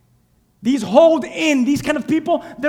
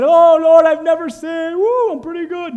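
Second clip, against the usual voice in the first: smug prayer; 3 semitones higher, 5 dB louder than usual. Acted. Smug Pharisee praying, voiced.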